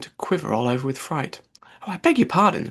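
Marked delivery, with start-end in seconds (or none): 1.8-2.7 hasty apology. Acted. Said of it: "cried Alice hastily".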